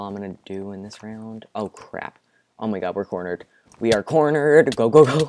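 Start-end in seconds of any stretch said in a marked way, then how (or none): 3.8-5.3 loud, high cry of alarm; panicked shout. Real, not acted. Player cornered by enemies urging escape, panicked shout. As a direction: yell it to get everyone moving.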